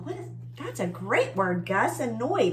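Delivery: ordinary delivery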